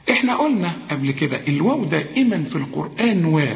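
usual speaking voice

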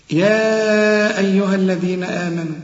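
slow, measured tajweed recitation; slow teaching recitation of a verse; Quran recitation. Shown as a demonstration. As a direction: recite with long, held vowels.

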